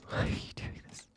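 breathless whisper, begging the game; pleading in a whisper, worn out; whispered plea. Real, not acted. Defeated player begging the obby game, whispered.

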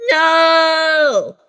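high, loud refusal; defiant shout. Pretend. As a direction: yell it back like a stubborn kid. Child refuses timeout.

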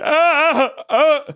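high loud whimpered ahs; hammed-up terrified sobbing; mock crying. Imitating the gripping passenger crying in turbulence. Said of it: "He's like".

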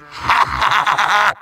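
big staccato villain laugh; evil cackle. Acted. Villain gloating over his foe's doom, evil cackle.

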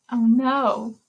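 usual speaking voice

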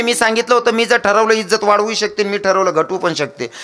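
high-pitched acted retort; retorting wife. Wife answers her scolding husband, high-pitched retort. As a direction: argue back as the wife, pitch up then easing down.

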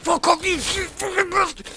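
high, screaming rage at the other players; furious shout. Real, not acted. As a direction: scream it out, losing it completely. Raging at opponents mid-game.

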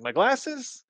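high, puzzled squeak; startled question. Retold or imitated. Kid asking the voice in his head, high and startled.

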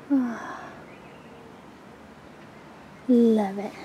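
normal talking voice

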